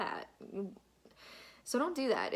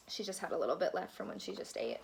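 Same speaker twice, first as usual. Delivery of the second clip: ordinary delivery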